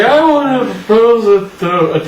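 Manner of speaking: hammed-up toast speech; drunken toast. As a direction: imitate a tipsy friend toasting the room. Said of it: "it was just about like that".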